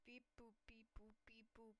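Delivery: lightly voiced robot beeps on one level note; robot beeping. Crewmate busy at a task, robot beeps. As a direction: beep boop like a little robot.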